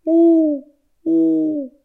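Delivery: two high, pure owl hoots. Acted. Joey shows what an owl sounds like, hooting. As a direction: hoot like an owl. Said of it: "An owl goes".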